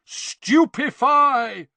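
high, loud, drawn-out spell cry; long battle roar. A narrator's reading. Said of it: "roared twenty voices".

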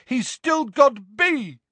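high, drawn-out shout; strained high-pitched call. A narrator's reading. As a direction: yell it high, stretching the last word. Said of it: "shouted a voice from above them".

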